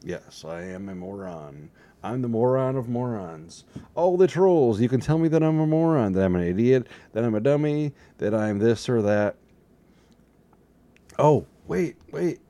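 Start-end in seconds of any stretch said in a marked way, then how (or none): 4.0-9.3 high, loud, fast rant; loud mock rant. Ranting invitation to trolls. 11.2-12.4 high, loud "oh wait"; sudden realization. Acted. Sudden interruption.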